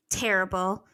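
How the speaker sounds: ordinary talk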